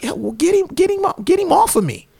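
high, insistent imitated begging; high-pitched comic desperation; mock frantic plea. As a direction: imitate a panicked man, stumbling over the plea. Retells naked man's plea.

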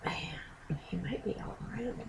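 hushed breathy whisper, quick. Real, not acted. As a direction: whisper it like a secret. Secretive aside about Michael Myers.

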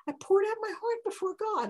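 high, earnest plea; earnest Hannah. Acted. Hannah explains to Eli, earnest and pleading. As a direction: lay your heart open.

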